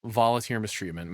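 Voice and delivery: ordinary talk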